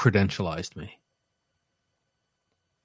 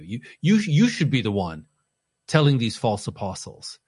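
ordinary talk, nothing marked

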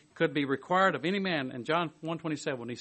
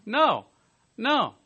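ordinary talk, nothing marked

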